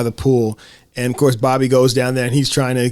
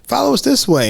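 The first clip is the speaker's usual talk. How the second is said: put-on kid voice; 11 semitones higher than usual. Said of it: "And they're like".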